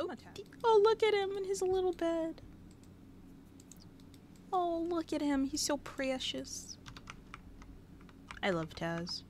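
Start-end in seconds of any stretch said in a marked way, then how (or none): 0.0-6.8 much higher cooing voice; baby talk. Acted. Cooing at Taz in his little bed, high baby talk. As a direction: coo over the puppy.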